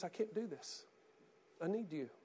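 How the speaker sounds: soft, pleading prayer voice; quiet cry to God. Retold or imitated. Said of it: "He cries out and says".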